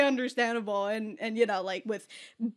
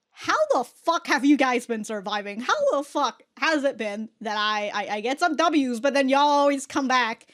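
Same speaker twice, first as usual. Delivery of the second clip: higher, louder incredulous rant; exasperated disbelief; 4 semitones higher, 6 dB louder than usual. Retold or imitated. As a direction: rant at their stupidity in disbelief. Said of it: "Catra is trying to figure out".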